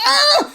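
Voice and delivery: short high-pitched "ah!"; mock scream. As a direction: re-enact a panicked yelp. Re-enacts panicked reaction to the call.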